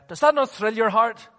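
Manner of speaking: high, forceful pulpit question; insistent re-asking. Real, not acted. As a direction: press the congregation for a response. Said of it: "I'll ask you that one again".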